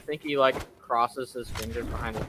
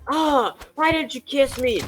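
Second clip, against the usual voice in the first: high whiny voice; whiny Fiona; 12 semitones higher, 4 dB louder than usual. Retold or imitated. Fiona whining at Shrek, high whiny voice. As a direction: whine it like a spoiled princess.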